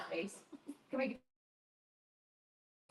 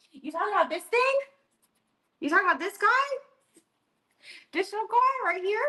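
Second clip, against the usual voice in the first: much higher doggy talk; pet voice; 12 semitones higher, faster than usual. Real, not acted. Streamer showing her dog to chat, high cooing pet voice. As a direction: coo over the little guy for viewers.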